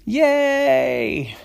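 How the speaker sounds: high, loud cheer. Acted. Sisters cheering the rescued cat.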